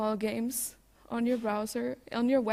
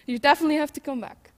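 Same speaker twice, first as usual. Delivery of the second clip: excited; 4 semitones higher, 10 dB louder, much faster than usual. Real, not acted. Laughing urging audience to visit.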